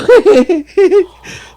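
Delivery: loud, very high tripping giggle; high giggling burst. Retold or imitated. Tripping driver cracking up at friends, high giggle. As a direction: cackle like the shrooms just hit. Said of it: "The only thing I do is go".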